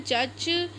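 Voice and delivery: usual speaking voice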